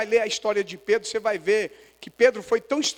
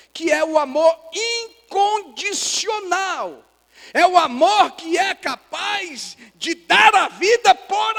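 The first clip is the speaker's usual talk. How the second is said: high, slow preacher's cry; impassioned preaching; 8 semitones higher, 5 dB louder, slower than usual. Real, not acted. Pastor explains unconditional love to the church, impassioned preaching. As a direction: preach it with rising force, stretching key words.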